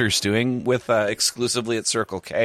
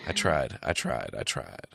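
each repeat lower and quieter; fading resignation; 11 semitones lower, 9 dB quieter than usual. Real, not acted. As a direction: let it die away into defeat.

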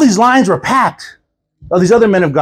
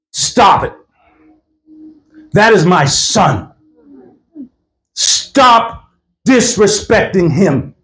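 slow, stern command with long pauses; God; much slower than usual. Retold or imitated. Rebuking a woman for disrespecting his son, slow and stern. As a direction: rebuke firmly, word by weighty word. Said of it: "and then God says".

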